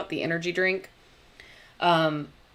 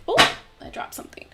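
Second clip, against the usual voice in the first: quick breathy startled aside; startled whisper; 4 dB louder, faster than usual. Real, not acted. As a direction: gasp it out, high and fast. Drops something mid-stream.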